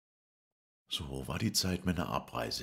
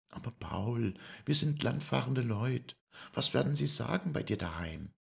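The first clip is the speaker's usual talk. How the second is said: much higher, worried voice; Lisei's voice; 11 semitones higher, 4 dB louder than usual. Acted. Lisei worries what the town will say, much higher voice. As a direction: fret in a high voice.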